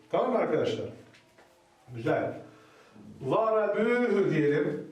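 ordinary delivery

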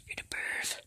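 very soft, voiceless taunt; hushed teasing whisper; accusing whisper. Real, not acted.

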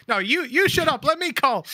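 loud, high mock shouting over teammates; imitated shouting. Players shouting over each other on team comms, mock shouting. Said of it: "everybody's just kinda shouting".

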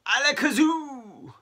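high, loud, drawn-out spell; magician's incantation. Shown as a demonstration. Keith waving his wand around, grand spell-casting cry. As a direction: proclaim the spell, stretching every vowel.

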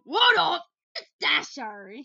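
loud, high greeting as Dasher. Pretend. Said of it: "Dasher wants to play".

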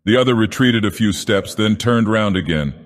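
ordinary delivery

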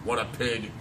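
usual speaking voice

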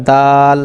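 slow দাল with the aa held long; drawn-out letter name. Shown as a demonstration. Teacher modelling the letter after the rule, stretched দাল. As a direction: hold the vowel of দাল for one alif. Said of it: "টান দিয়ে".

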